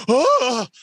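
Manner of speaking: high sudden yelp of fright; startled yelp. Retold or imitated. Caught naked by the spotlight, high startled yelp. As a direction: jump with a short cry. Jumps up when spotlight hits.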